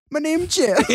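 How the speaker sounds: high dopey meme voice through laughter. Retold or imitated. Host asking if Jeffree said it, dopey meme voice. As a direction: quote the meme like a dim guy. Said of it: "has ever said".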